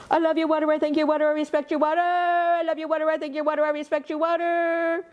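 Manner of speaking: put-on high, rushed child's rattle of the chant; rapid-fire high little boy voice; said real fast. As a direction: race through it like an excited little boy. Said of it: "wouldn't it be more fun if you said it real fast".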